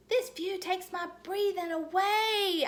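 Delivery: high, loud and slow, full of wonder; excited holler. A narrator's reading. Junie B hollering to her parents about the view. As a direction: holler over the plane noise. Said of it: "I hollered".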